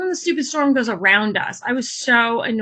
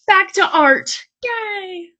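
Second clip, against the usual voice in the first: louder, higher and bright for back to art; excited cheer; 9 semitones higher, 4 dB louder, much slower than usual. Real, not acted. Vlogger turns from beach story back to art, excited cheer. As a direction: throw a happy cheer into Yay.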